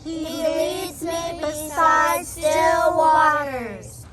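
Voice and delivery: very slow, drawn out recitation; slow chanted psalm; solemn group recitation. Acted. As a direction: recite each word slowly together.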